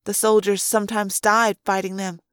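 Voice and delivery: ordinary delivery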